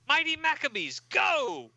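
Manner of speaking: high, rallying call to charge; mock battle cry. Hero sends team into battle, acted rallying cry. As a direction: launch the team with a heroic cry.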